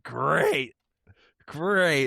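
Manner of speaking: high, sarcastic falling great. Retold or imitated. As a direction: fake cheer hiding dread. Sarcastic reaction to mother-in-law.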